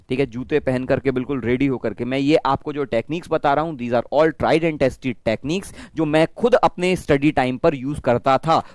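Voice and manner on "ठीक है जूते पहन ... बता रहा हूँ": normal talking voice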